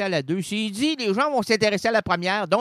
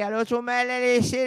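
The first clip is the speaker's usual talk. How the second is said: usual speaking voice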